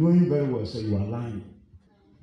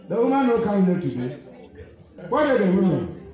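playful Pidgin; 6 semitones higher, 4 dB louder than usual. Retold or imitated. Preacher jokes in Pidgin.

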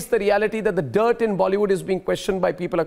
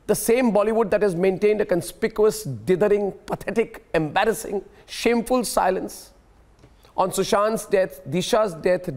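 ordinary delivery

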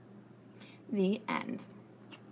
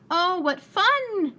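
slow, much higher and louder glee; delighted; 8 semitones higher, 6 dB louder, much slower than usual. A narrator's reading. Corduroy sliding on soap flakes, delighted. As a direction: squeal it with a big smile. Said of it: "he said with a smile".

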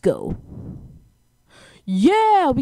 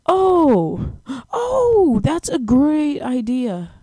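shocked; 6 semitones higher, 5 dB louder, much slower than usual. Real, not acted. Sarcastic shock at lasers.

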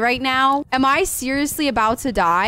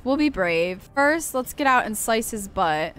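ordinary talk

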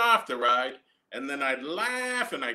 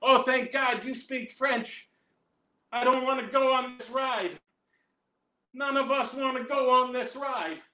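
put-on high, pleading child's voice; frightened kids; 7 semitones higher than usual. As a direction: beg like a panicked kid. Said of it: "the kids would say stuff in French like".

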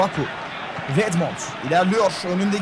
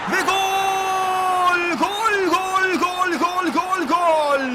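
screaming football commentator; 10 semitones higher, much slower than usual. Real, not acted. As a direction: yell the goal at full pitch. Second goal, commentator erupts.

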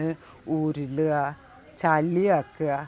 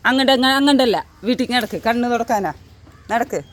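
high, raised commanding voice; urgent ordering; 4 semitones higher, 3 dB louder than usual. Real, not acted. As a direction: herd a pet homeward. Ordering dog to walk home. (ഉറക്കെ ആജ്ഞാപിക്കുന്നു)

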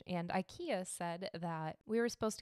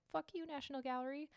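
high, softened mock defiance; playful snub; 7 semitones higher, 5 dB quieter than usual. Rijksmuseum to its rival gallery, playful snub. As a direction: toss off a mock insult in a small high voice.

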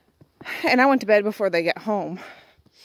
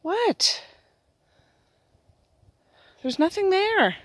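high coaxing voice to dog; 10 semitones higher, slower than usual. Real, not acted. Talking to dog outside.

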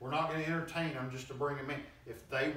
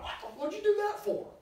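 put-on whiny child; 4 dB louder than usual. Child reacting to mom's refusal.

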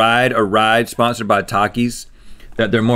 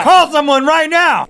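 excited; 6 dB louder, slower than usual. Real, not acted. Excited outburst.

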